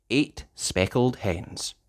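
ordinary delivery

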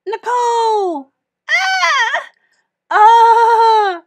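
loud high shrieks of shock; screaming. Acted. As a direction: scream like the kiss is horrifying. Kids shriek at the kiss.

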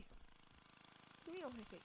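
unvoiced slow whisper. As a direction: hush it out slowly. Whispered repeat after shout.

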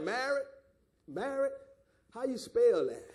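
mock puzzled questioning; weak, feigned bewilderment; powerless, clueless hesitation. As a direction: act unsure of the very word, halting between tries. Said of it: "if you ain't got no power, you be like".

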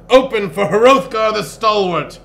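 bellow. A narrator's reading. Barbarian demands entry to the shop, bellowing. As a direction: proclaim yourself at full volume. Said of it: "he bellowed".

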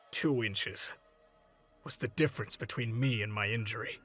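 low, soft, measured reflection; subdued realization. Acted. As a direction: reason it out gravely and quietly. Grave reckoning of what might differ.

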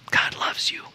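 soft unvoiced whisper; hushed reassurance to the congregation. Real, not acted. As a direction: whisper it close and tender. Tender whisper at altar call.

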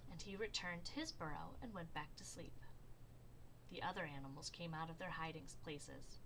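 plain reading voice

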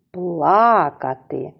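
"Плакати" slowly, with long stressed Пла-; stress on first syllable. Shown as a demonstration. Teacher showing children the stressed syllable, long first syllable. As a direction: say "Плакати" as PLAA-katy. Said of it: "падає на перший склад".

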